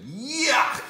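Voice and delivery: high loud disgusted cry of yuck. A narrator's reading. Andrew rejecting the pajamas, loud disgusted yuck. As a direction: spit out a kid's yuck.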